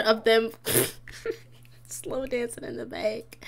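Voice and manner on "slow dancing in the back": unvoiced whispered aside; hushed whisper. Real, not acted. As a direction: whisper like you're spilling gossip. Gossiping about couple.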